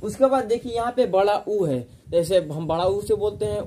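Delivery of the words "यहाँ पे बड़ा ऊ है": ordinary delivery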